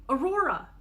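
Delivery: loud, high yell. Acted. Sister yelling to her sister in traffic, loud. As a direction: shout her name again. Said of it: "I yell again".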